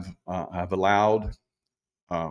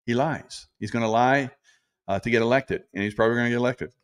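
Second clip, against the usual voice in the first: ordinary talk, nothing marked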